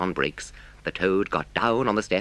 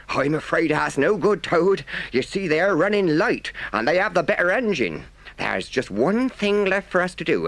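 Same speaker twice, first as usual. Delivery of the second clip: higher, urgent engine driver giving the plan; 7 semitones higher than usual. Acted. Driver explaining the tunnel plan to Toad, urgent and high. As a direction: lay out the escape plan high and pressing.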